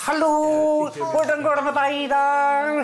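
high, slow comic character greeting; Steinar character voice. Retold or imitated. Comedian in his Steinar character, greeting the audience slowly and high. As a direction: sing out a dopey high hello. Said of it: "hvor han gjorde Steinar-figuren".

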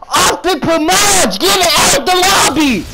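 high-pitched angry gamer yell; outraged, high shouting; getting mad. Real, not acted. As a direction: yell furiously at the player who got you. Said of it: "I'm getting mad".

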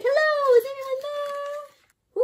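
high, loud then drawn-out call; high lonely hello into space. Acted. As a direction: call out hopefully, slow. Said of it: "She's like".

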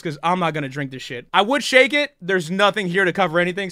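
ordinary talk

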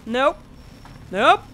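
normal talking voice

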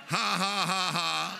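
a deliberate staged ha ha; faith laugh. Shown as a demonstration. Preacher shows the faith laugh to the church. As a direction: fake a hearty laugh. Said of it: "A faith laugh".

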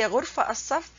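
غرفة said "ghurfa", no t, straight into الصف; wrong pronunciation, t dropped. Shown as a demonstration. Teacher shows a meaningless version, غرفة pronounced "ghurfa". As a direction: leave the t off غرفة. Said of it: "that has no meaning".

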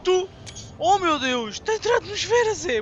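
loud, rising alarmed yells; panicked shouting. Real, not acted. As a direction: yell in growing panic as the ship burns in. Gamer panics entering atmosphere.

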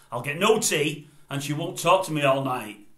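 ordinary delivery

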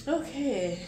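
ordinary delivery

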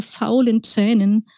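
plain reading voice

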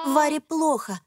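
plain reading voice

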